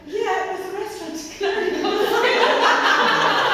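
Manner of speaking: high, loud, laughing through a naive answer; laughing reply. Retold or imitated. She tells the headmaster about the restaurant, laughing high and loud.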